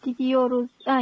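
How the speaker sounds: ordinary talk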